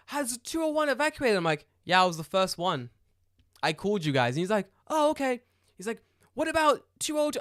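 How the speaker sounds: high, lightly voiced fire officer; mock fireman. Fireman asking about flats, lightly voiced and high.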